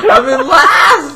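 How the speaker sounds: high, slow, drawn-out complaint; anguished wail. Real, not acted. Player who wants to win is last, anguished wail. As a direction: wail it in despair. Wailing about last place.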